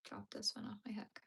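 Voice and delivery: breathy whisper with a sigh first, murmured to herself; sigh, then whisper it under your breath; whispered aside. Real, not acted. Streamer crocheting, talking to herself over her yarn, whispered.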